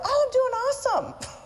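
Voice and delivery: high, bright over-cheerful answer; chirpy reply. Retold or imitated. Answering the audience instead of the clock, high chirpy misfire. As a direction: chirp it as if someone asked how you are. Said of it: "and I was like".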